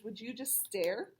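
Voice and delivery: plain reading voice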